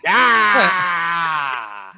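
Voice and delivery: loud whooping yeah; excited shout. Real, not acted. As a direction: cheer the joke, loud and high. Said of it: "reaction shout after joke".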